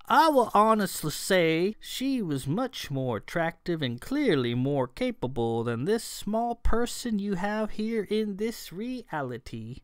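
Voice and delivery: put-on leisurely, swooping elf voice with an accent; accented elfin traveler. A narrator's reading. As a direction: stretch key words like an ancient, courtly elf.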